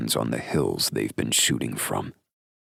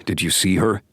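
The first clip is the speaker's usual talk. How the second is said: higher, urgent male voice; gruff friend voice; 7 semitones higher, 4 dB louder than usual. Acted. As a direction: press him for an answer. Drake voiced by narrator.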